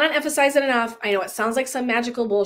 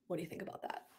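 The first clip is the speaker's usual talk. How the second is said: much softer, hushed and secretive; conspiratorial hush; 6 semitones lower, 21 dB quieter, faster than usual. Retold or imitated. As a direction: ask it under your breath. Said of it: "she's conspiratorial asking me".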